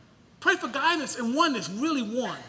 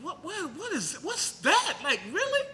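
incredulous; 6 semitones higher than usual. Retold or imitated. Acts out disbelief at name-calling.

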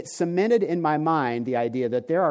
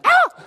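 sudden high, loud scream of fright; mock shriek; 10 dB louder than usual. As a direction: yelp as if the clown picture jumped out. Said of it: "three people went".

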